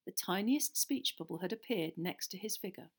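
ordinary delivery

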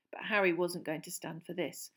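usual speaking voice

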